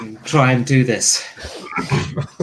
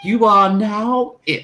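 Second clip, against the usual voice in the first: Margaret Thatcher voice; 8 semitones higher, much slower than usual. Acted. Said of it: "It has the voice of Margaret Thatcher".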